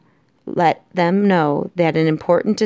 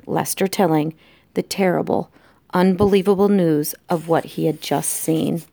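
usual speaking voice

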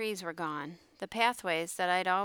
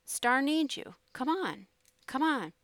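higher-pitched coaxing; urging; 7 semitones higher than usual. A narrator's reading. Jake begs the mule to climb the ramp, urging. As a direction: coax a reluctant animal along.

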